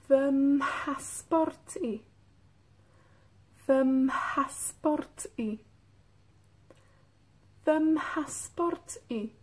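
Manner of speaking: "Vemheni" said slowly with a breathy mh; slow model for repeating. Shown as a demonstration. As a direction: stretch out each syllable for learners to copy. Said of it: "Escuchen cómo se, cómo suena". (lento y claro para que repitan: Vemheni)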